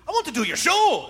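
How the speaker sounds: a rough imitation of a Scottish accent. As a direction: do Craig's line in a loose Scottish brogue. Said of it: "after my Scottish accent".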